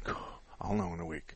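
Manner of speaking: hushed, under the breath; quiet aside. Retold or imitated. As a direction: mutter it to yourself. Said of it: "I'm going".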